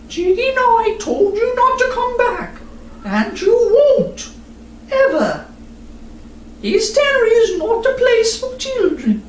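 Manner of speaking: high, firm mother's warning; serious mother. Acted. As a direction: warn her daughter seriously.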